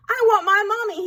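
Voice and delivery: mock-tearful plea in a small high voice. A narrator's reading. Lost duckling wants his mother, tearful. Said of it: "A big fat tear rolled down".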